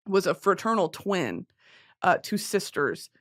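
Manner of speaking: normal talking voice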